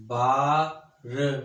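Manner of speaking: बारवा stretched very slowly, each sound held; slow drawn out. Shown as a demonstration. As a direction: draw बारवा out slowly for the students. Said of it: "जैसे देखें ना". (बारवा को धीरे धीरे खींचकर)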